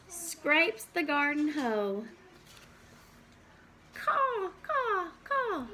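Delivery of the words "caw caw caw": high cawing crow cry; crow caws. Acted. Teacher reading farm book to kids, high crow caws.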